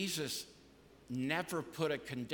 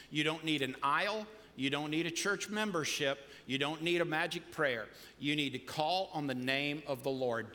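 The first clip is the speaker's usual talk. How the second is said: ordinary talk, nothing marked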